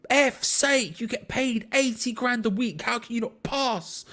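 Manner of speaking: high, drawn-out angry rant, volume held back; furious retold scream. Fan slamming the pub table at a misplaced pass, fuming. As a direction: re-enact fuming at a player, slow and high. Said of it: "screaming it, like, so angry".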